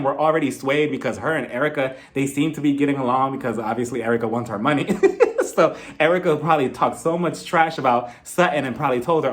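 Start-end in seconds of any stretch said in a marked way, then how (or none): 4.9-5.5 quick high laugh. Real, not acted. Laughing at own joke.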